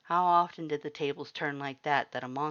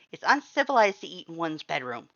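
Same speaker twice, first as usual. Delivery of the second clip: prim chef; 3 semitones higher, 7 dB louder than usual. A narrator's reading. Papyrus insists at dinner.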